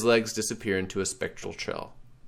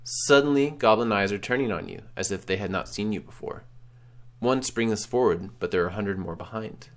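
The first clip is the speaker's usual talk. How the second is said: ordinary talk, nothing marked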